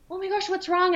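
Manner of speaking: high-pitched alarmed retelling; mock-anxious quote of yourself; worried. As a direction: fret over someone you love, voice lifted high. Retelling her worry at mom crying.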